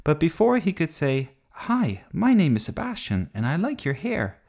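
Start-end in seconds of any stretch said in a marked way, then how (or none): 1.5-4.4 high-pitched, eager little introduction; eager boy's greeting. A narrator's reading. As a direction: introduce yourself like a smitten kid. Boy's imagined greeting to girl.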